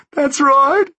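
very high and loud, pleading through tears; sobbing. A narrator's reading. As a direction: break down begging. Sobbing hitman agreeing.

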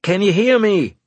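high, loud call through a bad link; demanding shout. A narrator's reading. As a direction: shout to be heard over static. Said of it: "I demanded".

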